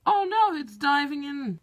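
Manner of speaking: excited alarm. Acted. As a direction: gasp it out as the animal dives. Documentary narrator alarmed.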